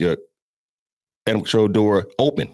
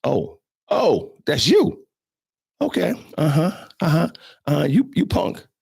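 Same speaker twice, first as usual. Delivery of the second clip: much higher, sneering dog voice, last words whispered; dog smelling fear; 8 semitones higher than usual. Retold or imitated. Dog smells the officer's fear, high mocking sneer. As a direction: play the dog who knows you're scared, mocking. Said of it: "The dog's like".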